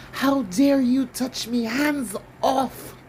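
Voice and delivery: high, slow indignant royal voice; haughty royal. Acted. Mock royal, reading about a suspect, snaps indignantly. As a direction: play the offended royal brushing off a guard. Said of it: "I got into character".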